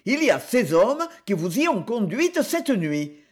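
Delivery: ordinary delivery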